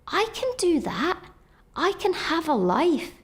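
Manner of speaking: hopeful resolve. Retold or imitated. Patient realising others live full lives, uplifted and hopeful. Elated realisation after discharge.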